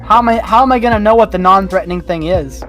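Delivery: ordinary delivery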